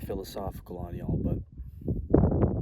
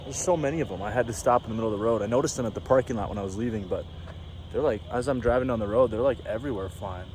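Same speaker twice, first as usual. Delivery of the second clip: louder, fast and eager; excited; 2 semitones higher, 5 dB louder, faster than usual. Real, not acted. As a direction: spill it out, thrilled by the swarm. Rushes to tell about the bats.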